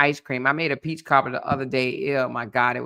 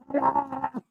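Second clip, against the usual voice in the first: lady's phone hello; 9 semitones higher, 3 dB quieter than usual. Retold or imitated. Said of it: "She answered the phone. Here she go".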